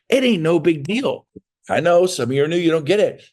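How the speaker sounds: usual speaking voice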